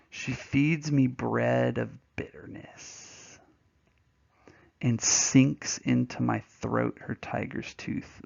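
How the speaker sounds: very slow, drawn-out verse recital; dramatic poem reading. Acted. Teacher reading a poem to students, slow dramatic recital. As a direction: linger heavily on each word of the verse.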